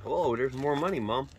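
ordinary talk, nothing marked

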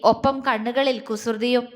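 ordinary delivery

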